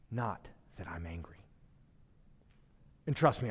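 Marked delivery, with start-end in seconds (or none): 0.1-1.4 whisper. Real, not acted. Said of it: "unvoiced whisper".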